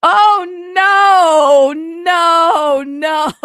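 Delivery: loud, high, drawn-out cry of dismay; dismayed wail. Acted. Reader appalled by the Carlton revelation, wailing. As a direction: wail each no long and loud.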